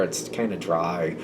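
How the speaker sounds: downplay it under your breath; dismissive aside. Retold or imitated. Coworker dismissing the cake.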